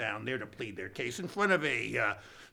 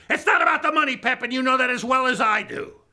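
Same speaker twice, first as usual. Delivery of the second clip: loud, higher mock-indignant protest; 9 semitones higher, 10 dB louder, faster than usual. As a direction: huff about it like an offended billionaire. Rich brother indignant.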